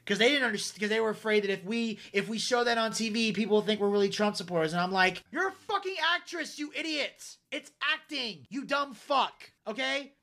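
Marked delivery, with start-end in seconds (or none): 5.3-10.1 high-pitched indignant insult; raised pitch, emphatic scorn; exasperated rant. Retold or imitated. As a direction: berate them as if they're hopeless. Rant at cowardly actors.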